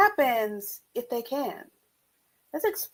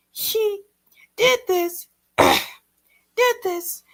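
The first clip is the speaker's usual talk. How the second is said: higher, halting tearful voice; 11 semitones higher than usual. A narrator's reading. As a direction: choke up as you speak. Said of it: "tears fell from her eyes".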